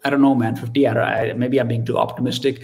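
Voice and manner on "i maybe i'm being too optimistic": ordinary talk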